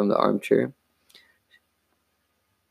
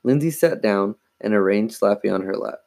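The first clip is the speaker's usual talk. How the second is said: plain reading voice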